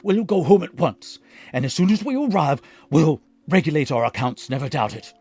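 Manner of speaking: threatening carpenter dragging his puppet home. A narrator's reading. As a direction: scold the runaway puppet, threatening. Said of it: "shaking his head threateningly".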